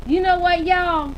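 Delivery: put-on loud, high playful Southern accent. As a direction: call it out to the room in a Southern accent. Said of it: "I'm gonna say it in my Southern accent".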